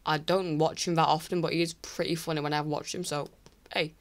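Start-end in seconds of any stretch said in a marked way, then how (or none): none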